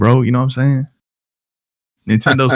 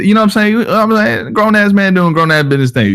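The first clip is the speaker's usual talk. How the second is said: pitched up teasing excuse; high joking voice; 10 semitones higher than usual. Real, not acted.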